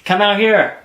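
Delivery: loud high call. Real, not acted. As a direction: call across the house. Calling to her in other room.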